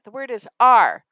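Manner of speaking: Ar said firmly and loud; loud model reading. Shown as a demonstration. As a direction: state "The word is R" loudly, teacher model. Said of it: "I'll say it".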